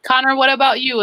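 normal talking voice

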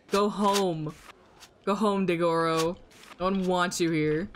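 ordinary delivery

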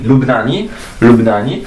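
lubnani with a clear final i; Arabic pronunciation. Shown as a demonstration. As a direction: model lubnani for the class. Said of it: "ny i i". (arabska wymowa lubnani)

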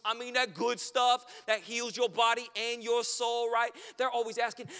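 ordinary delivery

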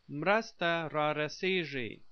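Mrasta rarasigi with zh for the g; alternative pronunciation. Shown as a demonstration. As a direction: say Mrasta rarasigi ending in -see-zhee. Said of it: "also pronounced".

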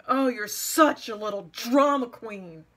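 slow, drawn-out taunt, imitated; retold mocking. Critic jeering the moment she says one thing, slow mocking imitation. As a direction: stretch out the insult in mock scorn. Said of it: "she mocked me for being a drama queen".

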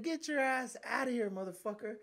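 high-pitched mock yell at the old man; retold yell. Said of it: "I said".

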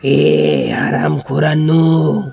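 long, very loud angry yell; furious shout. Acted. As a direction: bellow it out and drag the words. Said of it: "तो जोरात ओरडलो". (रागाने जोरात ओरडणे)

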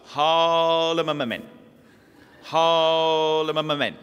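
"Ha le ma ma men" slow and drawn out, words run together; slurred drawn-out prayer. Retold or imitated. Aunt leading the rosary, slow blurred chant. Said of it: "Auntie Claire would start".

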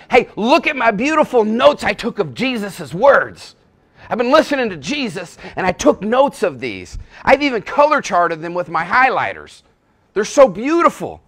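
loud, high, gushing show-off voice; a preacher hamming up a boastful churchgoer; proud note-taker. Acted. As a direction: brag loudly about your pretty notes. Boastful note-taker parodied in sermon.

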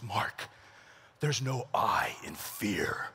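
low, hushed, very slow guru delivery; mock-profound instructor. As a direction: whisper it like deep wisdom, drawn out. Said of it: "He tries to be all profound".

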